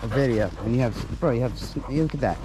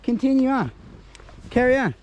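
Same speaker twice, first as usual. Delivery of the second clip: much higher, prissy dog voice; a snooty little dog brushing off his owner; dog snootily dismissing Dad's pee-spot route, high voice; voicing the dog; 11 semitones higher than usual. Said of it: "it's like".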